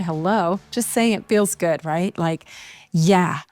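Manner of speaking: normal talking voice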